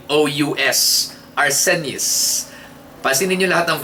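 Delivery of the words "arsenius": Arsenius, slow with a falling end; slow careful word. Shown as a demonstration. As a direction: draw out Arsenius once more. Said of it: "Ang spelling ng word". (mabagal na pagbigkas ng Arsenius)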